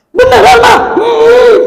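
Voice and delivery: put-on high, bright child's question; eager child. Child asking mother, high and eager. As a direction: voice the delighted kid.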